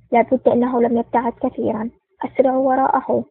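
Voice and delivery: ordinary delivery, nothing marked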